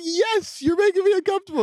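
very high falsetto retort; hammed-up squeaky reply; mock exasperation. Exasperated reply mimicking the silly accent.

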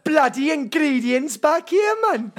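slow, high, loud Welsh sliding into Jamaican; Welsh turning Jamaican. Pretend. Friend doing his catchphrase, accent slipping into Jamaican. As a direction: let the mock Welsh drift Jamaican. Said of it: "I got a bit Jamaican".

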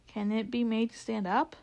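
ordinary delivery, nothing marked

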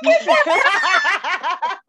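high, loud laughing "Get that"; laughing disbelief. Real, not acted. Reacting to a cousin's silly question with a high laughing burst.

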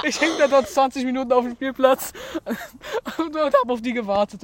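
laughing through the words; giggling while telling it. Real, not acted. Laughing at own embarrassing story.

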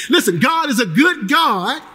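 usual speaking voice

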